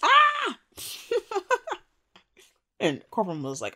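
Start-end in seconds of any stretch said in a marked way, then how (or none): 0.0-0.6 real high squeal of laughter; delighted shriek. Real, not acted. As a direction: shriek with glee.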